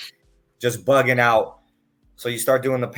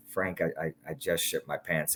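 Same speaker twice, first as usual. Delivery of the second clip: softer, lower sheepish admission; ashamed confession; 5 semitones lower, 9 dB quieter than usual. Retold or imitated. The student quietly confesses to Frank, softer and lower. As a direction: mumble it out in shame.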